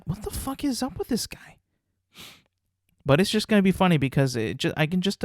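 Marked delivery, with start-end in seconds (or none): 0.1-1.6 baffled. Retold or imitated. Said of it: "And everybody was like".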